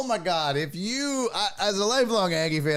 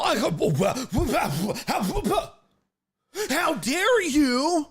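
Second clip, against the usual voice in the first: higher, hammed-up offended voice; mocking the coach; 7 semitones higher than usual. Mocking the coach's loyalty pledge, hammed-up and high. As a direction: play the coach as a huffy fake loyalist. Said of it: "He goes all".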